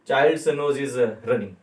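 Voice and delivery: slow, careful model sentence; drawn-out teaching read; slow and easy. Shown as a demonstration. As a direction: say each word slowly for learners. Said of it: "आराम से बोल सकते हैं".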